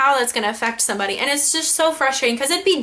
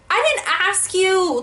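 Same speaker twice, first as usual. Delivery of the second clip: annoyed retort; 7 semitones higher than usual. Retold or imitated. Snapping back at sister.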